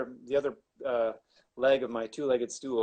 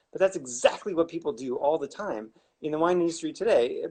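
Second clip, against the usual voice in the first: ordinary talk, nothing marked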